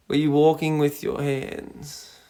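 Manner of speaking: ordinary talk, nothing marked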